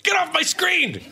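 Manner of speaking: high, slow mock shout; mock yell. Panelist shouting at a stuck voicemail notification, high and slow.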